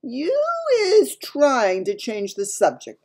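loud, high, slow reprimand; stern giant. A narrator's reading. Giant catching Sophie changing the subject, stern and slow. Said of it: "the giant said sternly".

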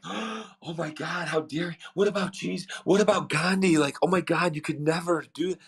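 clutch your pearls, how dare he; scandalized crowd. Retold or imitated. The lunch crowd reacting to Gary's goal, scandalized and indignant. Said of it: "And everyone was like".

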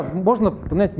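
normal talking voice

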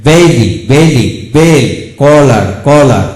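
কলার drawn out slowly with one ল; demo pronunciation. Shown as a demonstration. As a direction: teach কলার slowly, syllable by syllable. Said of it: "এভাবে হবে". (কলার শব্দটি ধীরে, এক ল দিয়ে)

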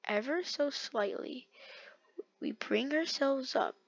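soft, slow coaxing; slow, delicate, under tension; careful concentration. Real, not acted. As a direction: hush it and ease it along.

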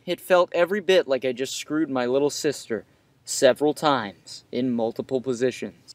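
usual speaking voice

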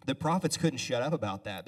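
normal talking voice

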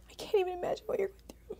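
high, quick, tearful voice; really fighting back tears while speaking; choked up. Real, not acted. Mom imagining a message to her estranged child, choked up. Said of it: "I'm sorry I'm getting emotional".

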